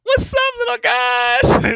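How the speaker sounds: very high drawn-out shouted hello; cutesy pet greeting. Retold or imitated. Owner calling his cats at the door, high and slow. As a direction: sing it out to the cats like babies.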